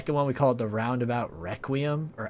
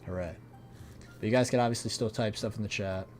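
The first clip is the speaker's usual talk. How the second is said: eating while talking; mouth full; 5 dB quieter than usual. Real, not acted. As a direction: talk through a mouthful of food. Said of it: "an A S M R mukbang".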